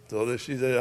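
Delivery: ordinary delivery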